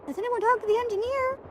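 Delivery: high, dramatic mock alarm; hammed-up gasping question. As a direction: gasp and ask it like a shocked heroine. Gasps, repeats line dramatically.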